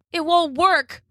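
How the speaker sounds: put-on high, worried boy's voice; worried kid voice. A narrator's reading. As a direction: fret like a small boy. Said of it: "he said".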